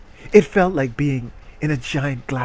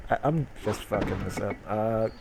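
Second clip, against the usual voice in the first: muttering; 5 semitones lower, 9 dB quieter than usual. Real, not acted. Muttering at game mistake.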